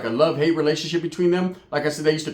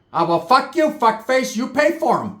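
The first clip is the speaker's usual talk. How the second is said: mock aunt snapping; 8 semitones higher, slower than usual. Aunt voiced snapping back.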